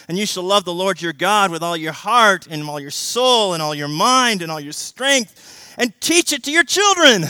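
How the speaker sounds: loud, high, urgent exhortation; preaching at full force. Acted. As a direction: hammer the call to action. Exhorting the congregation.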